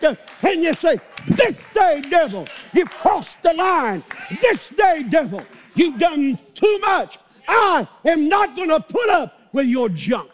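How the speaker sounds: high, loud defiant shouting; shouted preaching. Acted. Preacher telling the devil off, high shout. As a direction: rebuke the devil at full voice.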